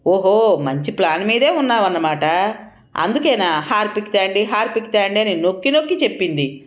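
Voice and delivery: ordinary delivery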